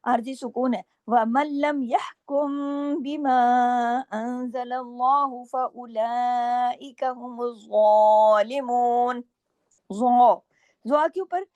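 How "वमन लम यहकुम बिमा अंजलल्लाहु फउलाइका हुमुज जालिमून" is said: slow melodic tajweed chant; slow Quranic recitation with held vowels. Shown as a demonstration. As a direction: recite the verse slowly, stretching each vowel. Recites Quran verse slowly.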